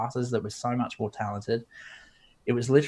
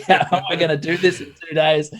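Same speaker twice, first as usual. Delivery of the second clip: half-laughing, louder and higher; laughing panic; 6 semitones higher, 5 dB louder than usual. Retold or imitated. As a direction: laugh through the worried question. Laughing at own panic, retold.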